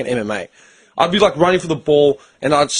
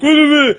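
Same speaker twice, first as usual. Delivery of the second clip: high, louder mocking wail; 12 semitones higher, 5 dB louder than usual. Teammates mocking the fallen guy, high whiny wail. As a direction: whine it like crying teammates. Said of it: "all these mates were like".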